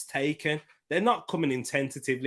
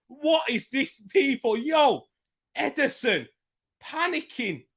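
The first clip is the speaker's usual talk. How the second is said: high, agitated fan reaction; excited disbelief; 12 semitones higher, slower than usual. Real, not acted. Fan talking to viewers about the keeper, high excited disbelief. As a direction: keep the pitch up, still amazed.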